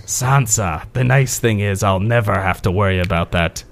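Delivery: low, sly scheming voice; Littlefinger impression. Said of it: "perfect for Littlefinger".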